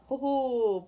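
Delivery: loud, high happy hoot; a bright cheer at the cards; excited whoop. Real, not acted. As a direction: whoop with real delight.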